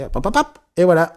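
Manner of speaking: a magician's quick patter, rising to a pop; brisk mock fix. Mimes an instant emotional fix.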